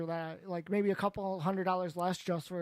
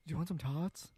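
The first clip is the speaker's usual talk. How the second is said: Napoleon Dynamite; 3 semitones lower, 5 dB quieter than usual. Retold or imitated. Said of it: "He goes".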